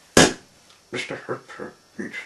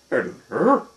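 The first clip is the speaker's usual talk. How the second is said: loud, higher storybook hello; lightly voiced greeting; 4 semitones higher, 12 dB louder than usual. A narrator's reading. Mister Hooper greets his lookalike, loud lightly acted hello. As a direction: greet the stranger loudly.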